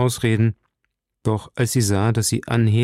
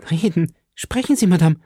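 energetic urging; 8 semitones higher than usual. A narrator's reading. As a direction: urge her to speak out. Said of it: "mit einer Energie fort". (energisch drängend, mit hoher Stimme)

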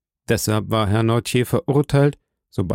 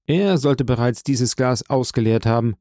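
ordinary delivery, nothing marked

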